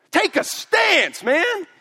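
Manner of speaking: slow, loud, high call to take a stance; shouted exhortation. Real, not acted. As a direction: drag each word out in a big shout. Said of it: "pastor shouting exhortation".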